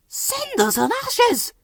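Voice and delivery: very high hushed alarm; a startled hushed cry; quiet wheezed exclaim. A narrator's reading. Thomas spotting Toby, quietly alarmed. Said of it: "Thomas wheezed quietly".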